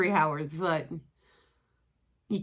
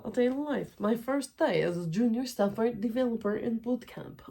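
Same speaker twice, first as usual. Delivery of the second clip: a lightly put-on, higher fake Russian accent; hammed-up fake Russian accent for her first day; 4 semitones higher than usual. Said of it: "we went with a fake Russian accent".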